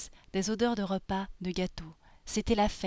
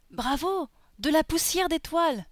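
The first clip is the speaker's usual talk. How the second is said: high, loud congratulation; delighted, raised character voice; exclaiming sorcerer; 7 semitones higher, 5 dB louder than usual. Acted. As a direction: cry out in wonder as an old-tale wizard. Sorcerer hails the star dust.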